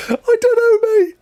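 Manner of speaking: high, laugh-broken I don't know; laughing helplessly. Real, not acted. Streamer cracking up after failing Welsh, high and laughing. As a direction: giggle through it.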